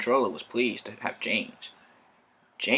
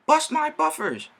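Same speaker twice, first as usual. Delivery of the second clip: high, louder cry of alarm; 5 dB louder than usual. Acted. Thomas grinding to a halt in the snow, crying out high. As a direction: cry out high as the engine stalls. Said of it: "cried Thomas".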